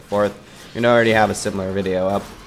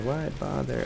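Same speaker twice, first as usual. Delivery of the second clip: softer, higher throwaway; softer aside; 4 semitones higher, 8 dB quieter than usual. Pretend. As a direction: toss it off quietly.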